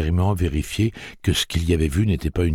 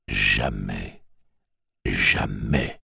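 low menacing repeat; 7 semitones lower, much slower than usual. A narrator's reading. Tic-Tac repeating jamais, low and menacing. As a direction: sink the word low twice.

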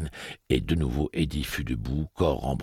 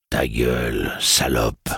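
growl; 6 dB louder, slower than usual. A narrator's reading. Roland growls a loud insult at Detta. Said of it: "gronda le pistolero".

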